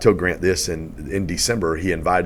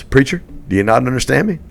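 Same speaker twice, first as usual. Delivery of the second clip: louder, higher imitation of a pressing mentor; insistent challenge; 6 semitones higher, 5 dB louder than usual. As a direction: press him across the table. Mentor confronts him across table.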